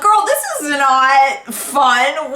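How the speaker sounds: slow, high, loud comic protest; mock exasperation. Patient to nurse who left gown open, mock exasperated. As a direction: drag out each word in playful outrage. Acted complaint to absent nurse.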